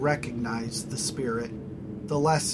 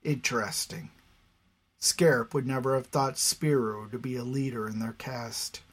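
ordinary delivery

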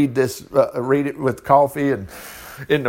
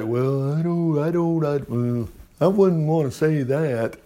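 mock hesitant believer; 3 dB quieter than usual.

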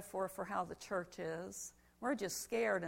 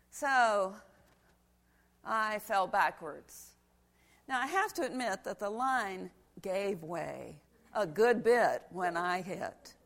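ordinary delivery

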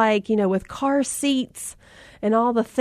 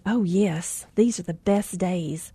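put-on soft, lower, knowing older lady; 4 semitones lower, 7 dB quieter, slower than usual. Older lady softly assuring a tired mom. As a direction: gently insist like a wise grandma. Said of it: "And she said".

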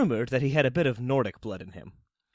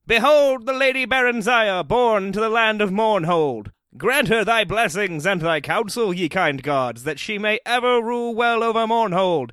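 priestly proclamation; 8 semitones higher, 6 dB louder than usual. A narrator's reading. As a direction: proclaim it to a vast crowd and the gods. High priest proclaims naming rite.

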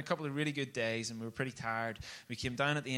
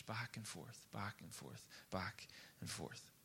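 much softer, dropping lower each time; fading repetition; 4 semitones lower, 16 dB quieter, slower than usual. Real, not acted. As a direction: let it die away, slowing at the end. Repeated cycle, voice fading away.

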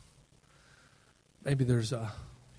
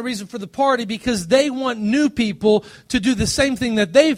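raised, louder sermon voice driving each line home; preaching at full force; 8 semitones higher, 5 dB louder than usual. Acted. Pastor urging the congregation toward the Father, preaching loud. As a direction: build to a stirring climax. Preaches against the stagnant church.

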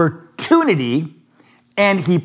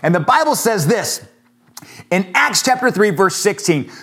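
normal talking voice

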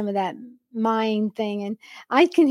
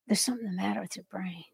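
softer, hushed, fast whisper of a husband's put-down; mock whisper; 3 semitones lower, 13 dB quieter, faster than usual. Husband whispering mind games to his wife, hushed and fast. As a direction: breathe the put-down in a sly hush.

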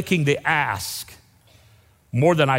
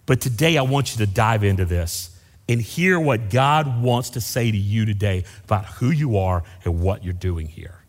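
ordinary delivery

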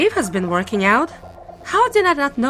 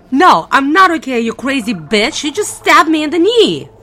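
angry retort; 6 semitones higher, 7 dB louder than usual. Acted. Stabbed captive snaps back.

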